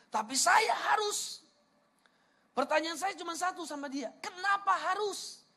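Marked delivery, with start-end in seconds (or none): 0.1-1.4 high, loud, drawn-out protest; acted insistence. Preacher imitating friend who must go clubbing, insistent. 4.2-5.4 high, stretched-out question; incredulous challenge. Retold or imitated. Preacher retelling his question to clubbing friend, incredulous.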